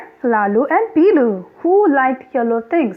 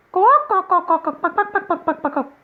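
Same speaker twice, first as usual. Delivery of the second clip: quacking duck sound; 5 semitones higher than usual. Retold or imitated. Teacher quacking like a duck for kids.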